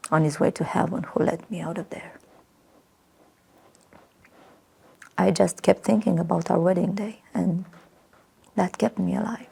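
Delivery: fading, hushed whisper. Acted. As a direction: let it sink to a whisper. Remembering being saved.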